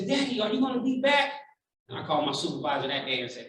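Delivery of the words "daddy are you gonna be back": put-on high-pitched child's question; lightly acted kid voice, pitched up; young daughter. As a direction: imitate a hopeful little girl asking Daddy. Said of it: "she says".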